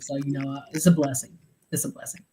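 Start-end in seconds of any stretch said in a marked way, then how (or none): 1.7-2.2 soft, breathy echo to herself; whispered repeat. Real, not acted. Mom whispering her gratitude for her kids, quietly. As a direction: whisper it again, almost to herself. Repeats the phrase, second softer.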